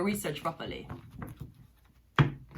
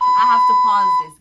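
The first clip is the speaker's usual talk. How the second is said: sudden loud outburst; 15 dB louder than usual. Real, not acted. Sudden interruption of the reading.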